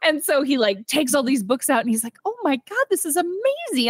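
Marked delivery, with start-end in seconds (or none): 2.2-3.9 much higher, thrilled gush; excited Ken. Retold or imitated. Ken finding library books on men, high thrilled gush. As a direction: gush like a kid who found treasure.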